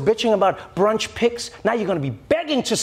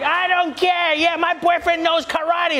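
put-on drunk woman; 10 semitones higher, slower than usual. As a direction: brag at full pitch like a tipsy brawler. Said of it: "It's like".

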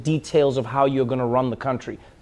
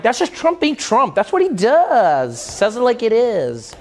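higher, louder, slow admiring drawl; Trump fans; 7 semitones higher, 4 dB louder, slower than usual. Retold or imitated. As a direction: shrug it off like a devoted fan. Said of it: "people are like".

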